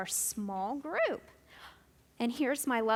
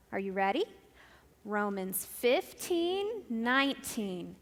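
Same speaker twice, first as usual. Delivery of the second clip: ordinary talk, nothing marked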